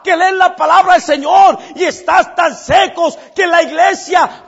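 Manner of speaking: high, loud slow sermon shout; fiery preaching. Acted. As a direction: thunder it from the pulpit. Preacher at full force.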